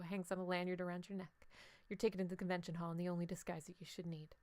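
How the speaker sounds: calm game master narration; scene narration. A narrator's reading. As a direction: set the scene for the players.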